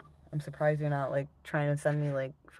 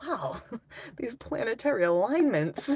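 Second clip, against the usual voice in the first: wow, higher and louder with amazement; mock awe; 9 semitones higher, 4 dB louder than usual. Streamer mimicking an astrology fan's amazement, with mock awe. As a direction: gush over the planets like a starstruck newbie. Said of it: "and you're like".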